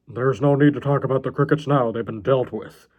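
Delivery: put-on villain voice, dropping at the end; deranged character shutting the subject down; ominous John. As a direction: deliver a veiled threat.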